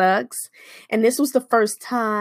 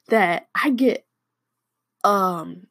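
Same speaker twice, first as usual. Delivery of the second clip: normal talking voice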